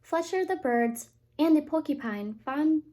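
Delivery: ordinary delivery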